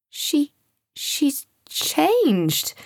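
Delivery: high, halting astonishment; amazed child, voice lifted high; complete amazement. A narrator's reading. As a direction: stumble on the first word in wonder. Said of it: "exclaimed to Sam in complete amazement".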